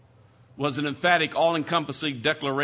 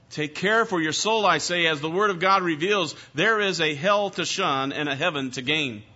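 louder, forceful warning; preaching; 5 semitones higher, 4 dB louder than usual. Acted. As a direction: warn them with conviction. Preaching a sermon.

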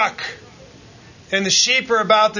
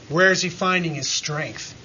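ordinary delivery

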